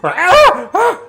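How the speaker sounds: high, loud shriek of surprise; a goblin caught off guard, yelping twice; startled goblin shriek. Acted. Goblin sees a flaming warrior round the corner, shrieking high and loud. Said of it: "the goblin sees you come around the corner and goes".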